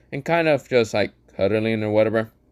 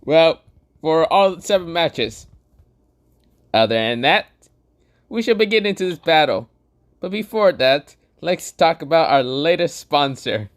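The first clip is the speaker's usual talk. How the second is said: Gojo voice; 7 semitones higher than usual. Acted. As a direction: play the cocky sorcerer, pitched up. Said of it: "Gojo says".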